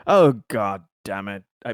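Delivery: normal talking voice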